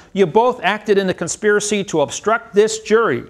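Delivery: high, insistent courtroom harangue; retold accusatory tirade. Defendant accuses DA of fraud in syntax, retold heated accusation. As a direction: re-enact pressing charge after charge.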